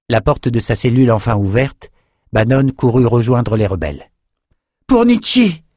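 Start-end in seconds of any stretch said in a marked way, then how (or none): 4.9-5.6 very high-pitched rallying shout; battle cry. Acted. Young fencer joining the rebels, shouting. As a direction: cry out the cause as you charge. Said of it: "cria-t-il".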